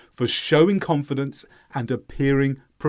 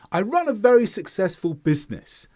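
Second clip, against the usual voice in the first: higher start, falling with certainty to BUSINESS; falling intonation; 4 semitones higher than usual. Shown as a demonstration. Teacher wanting listeners to believe it, falling to BUSINESS. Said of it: "Falling intonation indicates certainty".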